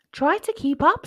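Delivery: high, loud impatient call; older sister nagging; sister cub chiding. A narrator's reading. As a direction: hurry the little brother along. Sister cub chides Tejas.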